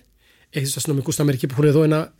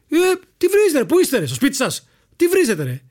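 high-pitched mock outburst at hecklers; indignant shout; 10 semitones higher than usual. Colleague turning on the fans cursing his friend, indignant high yell. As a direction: round on them suddenly, voice jumping up high.

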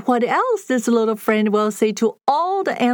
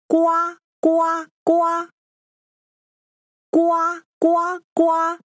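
duck quack; 6 semitones higher than usual. Shown as a demonstration. Duck sound guessing game.

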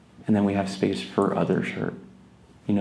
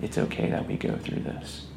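gentle acceptance; 6 semitones lower, 7 dB quieter than usual. Shown as a demonstration. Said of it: "just saying".

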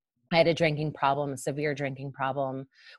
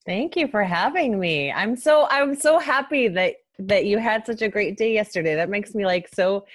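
high, loud, gushing with joy; bubbling over with happiness; giddy excited; 6 semitones higher, 7 dB louder than usual. Real, not acted. Guest gushes with joy.

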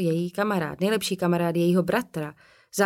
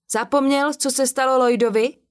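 alarmed warning; 7 semitones higher, 7 dB louder than usual. Acted. Martin warning Tom about Lloyd.

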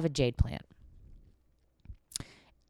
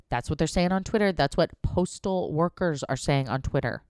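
usual speaking voice

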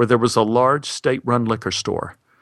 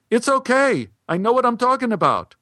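[name]'s voice; 12 semitones higher than usual. Acted. [name] insists on a case each, high and firm. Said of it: "I said".

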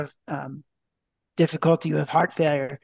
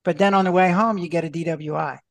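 ordinary delivery

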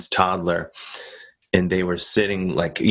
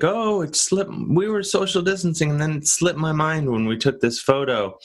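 mocking excuse; 9 semitones higher than usual.

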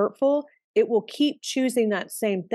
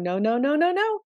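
a rising mock protest, last no jumps much higher; mock refusal; 6 semitones higher than usual. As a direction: ham up someone pushing the shadow away. Acting out resisting a shadow.

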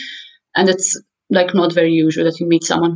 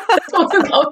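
laughing; 9 semitones higher than usual. Real, not acted.

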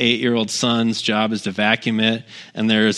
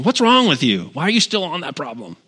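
raised, higher-pitched exasperated telling-off; mock scolding; 5 semitones higher than usual. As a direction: act out an accusing scold from above. Imagined scolding from heaven.

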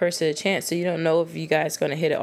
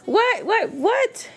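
climbing higher on each what; baffled what; much slower than usual. Real, not acted. Reacting to a guy's twisted logic, baffled and much higher. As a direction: squeak out the whats in disbelief. Baffled by guys' double standard.

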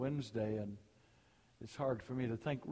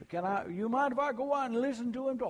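Cajun talk; 9 semitones higher, 3 dB louder than usual. Retold or imitated. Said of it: "I love to hear that Cajun talk".

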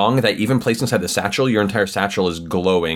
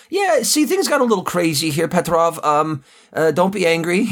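much higher, pleading, nervous henchman; nervous goon; 9 semitones higher than usual. Acted. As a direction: beg the boss not to be angry, voice up high. Goon excuses himself to Petrov.

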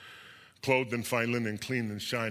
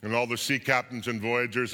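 ordinary delivery

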